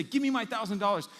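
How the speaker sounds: acted creditor's loud outburst; angry demand. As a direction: insist as if shaking him. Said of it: "He begins to shake him and say".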